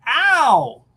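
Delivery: sharp high "Ow!" of sudden pain; loud startled cry of pain; pained yelp. Acted. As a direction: yelp as the bite lands. Peter bitten by spider.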